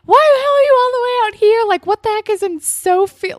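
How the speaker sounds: high, loud angry outburst; angry yell. Retold or imitated. Joey picking up the kids, pissed off, high angry yelling. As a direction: blow up at the kids. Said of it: "Joey is pissed off".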